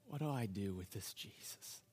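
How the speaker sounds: very soft, low private question; inward questioning. Acted. Pilate asking in his heart, hushed inward question. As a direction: murmur it as a thought from the heart. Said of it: "In his heart, he's asking".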